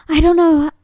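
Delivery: alarmed outburst. Acted. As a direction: blurt it out loudly, cut off short. Audition, telling intruder to leave.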